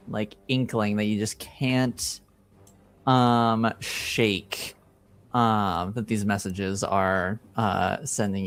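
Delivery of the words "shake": ordinary delivery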